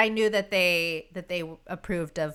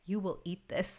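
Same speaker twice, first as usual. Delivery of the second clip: mock stern parent; 8 dB quieter than usual. Mom serving dinner to kids, mock stern. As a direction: lay down the law quietly, half joking. Mother voiced ordering kids to eat.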